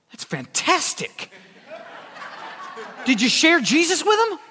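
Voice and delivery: high, laughing, delighted mock enthusiasm; mock cheerful Keith. Keith on the phone to beaten-up Steve, laughing and delighted. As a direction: laugh it out as if a beating were good news. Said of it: "And Keith's like".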